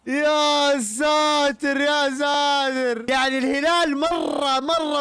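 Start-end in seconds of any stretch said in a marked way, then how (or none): 0.1-3.0 a long shout of disbelief at yet another goal; drawn-out disbelief. Real, not acted. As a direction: drag it out in amazement.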